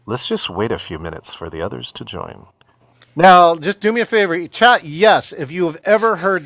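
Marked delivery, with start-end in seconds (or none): 0.0-2.5 low, monotone host voice, slightly subdued; stalling meeting host. Acted. Meeting host quoting the dreaded phrase to attendees, low monotone. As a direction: drone it low and even, like a tedious host.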